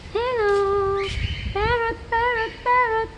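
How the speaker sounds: very high sing-out call; high-pitched pet call; calling a parrot. Real, not acted. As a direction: coax the bird over in a high voice.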